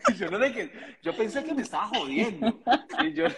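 high voice broken by laughter; laughing while telling friends the story. Real, not acted. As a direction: talk through real giggles.

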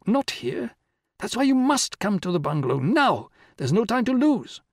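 high-pitched, pressing insistence; urgent. A narrator's reading. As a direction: urge him to come at once. Urging him to come at once.

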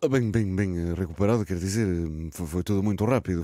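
hesitant Portuguese with a put-on Spanish accent; comic Spanish-accented Portuguese. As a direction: stumble through it like a Spanish-accented pigeon interviewee. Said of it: "o sotaque espanhol".

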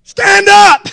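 high, loud bark of Stand up!; shouted command. Retold or imitated. Preacher retelling the guide shouting at the fisherman. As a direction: yell Stand up! as the punchline. Punchline of the fish story.

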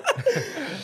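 sudden high laugh; high burst of laughter. Real, not acted. Laughs at co-host's joke.

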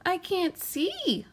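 small, high, slow creature voice; a timid little snail, high and slow; tiny snail voice. A narrator's reading. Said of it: "said the snail".

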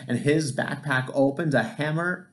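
usual speaking voice

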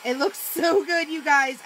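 excited. Real, not acted. Gushing to viewers.

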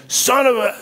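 high, cut-off curse of frustration; a believer blowing up at a setback, bitten-off curse; mock cussing outburst. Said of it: "you break out into all this reactionary stuff".

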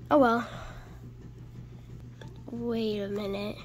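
ordinary delivery

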